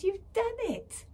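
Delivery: high, slow, loud delighted cry; Leggy cheering Quill, high and slow; thrilled cry. A narrator's reading. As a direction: celebrate his success. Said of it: "she said".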